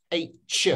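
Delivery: H said as "aitch", ending in ch. Shown as a demonstration. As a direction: finish H on a distinct ch, not sh. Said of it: "with a ch noise".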